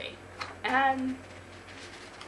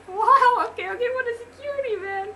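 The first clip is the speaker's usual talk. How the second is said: very high fangirl voice; gushing lady; 14 semitones higher, 8 dB louder than usual. Acted. Starstruck lady at the gym front desk, gushing squeal. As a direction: keep squealing in a high lady's voice.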